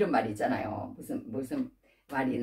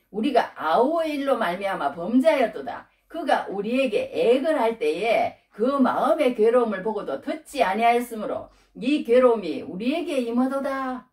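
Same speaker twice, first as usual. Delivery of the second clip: raised, measured recitation with rolling rises and falls; scripture declamation; 4 semitones higher, 3 dB louder than usual. Acted. Preacher reading the brothers' confession to the congregation, declaiming. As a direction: declaim the verse phrase by phrase. Reads the scripture verse aloud.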